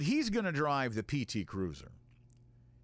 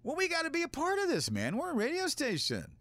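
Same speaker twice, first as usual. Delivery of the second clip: eager; 10 semitones higher than usual. Retold or imitated. Begging to join the parade.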